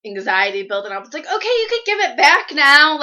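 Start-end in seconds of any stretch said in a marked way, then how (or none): 1.3-3.0 anxious impatience. Retold or imitated. Anxious when phone taken.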